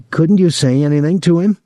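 high-pitched, desperate question. A narrator's reading. Boy appealing to the cook to intervene, desperate. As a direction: appeal to her, voice pushed up high. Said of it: "Thomas was desperate".

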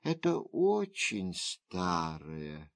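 low, soft, resigned; quietly weary woman; sighing reply. A narrator's reading. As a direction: let it out like a sigh. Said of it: "вздохнула госпожа Го".

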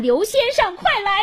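high, urgent calling out; loud cry for help. A narrator's reading. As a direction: shout for someone to come at once. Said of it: "大声喊".

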